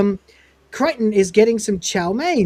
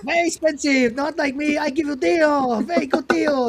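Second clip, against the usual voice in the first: put-on high-pitched caricatured Chinese accent; 7 semitones higher than usual. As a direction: dismiss a rival in a hammed-up Chinese-accented vendor voice. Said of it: "stereotype of a Chinese vendor".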